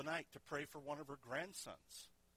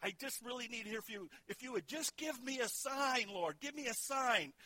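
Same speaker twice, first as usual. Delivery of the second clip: higher, begging for a sign; pleading prayer; 9 semitones higher than usual. Retold or imitated. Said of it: "he keeps on praying".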